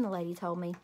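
ordinary delivery

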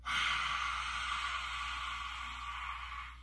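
long, soft unvoiced exhale; long dragon breath. Shown as a demonstration. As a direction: let the breath out slowly with the class. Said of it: "Now you try".

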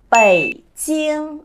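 Bảy Chiêng, slow, with a long open ringing final vowel; teacher modelling Bảy Chiêng; clear full vowel. Shown as a demonstration. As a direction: stretch Bảy Chiêng out round and resonant. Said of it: "cần phải đọc tròn rõ lên".

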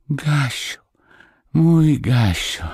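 high-pitched, thin-strength exclamation of joy; weak-voiced cry. A narrator's reading. Sick Marysia hugs her dog, crying out weakly. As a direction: cry the name up high, feebly. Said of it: "krzyknęła słabym głosem Marysia".

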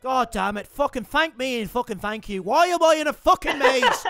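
cocky mock Irish accent, lightly done; a gamer playing brash Irish fighter Conor McGregor; swaggering put-on Irish brogue. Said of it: "My name's Conor McGregor".

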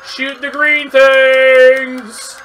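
excited gamer shout; shouted order. Real, not acted. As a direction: yell it to yourself. Calling out in game.

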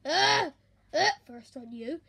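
ordinary talk

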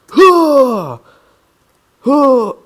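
high falling throwing yells; effort cries. Acted. Player throwing swords in game, effort cries. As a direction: heave each one out loudly.

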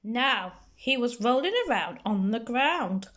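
ordinary delivery, nothing marked

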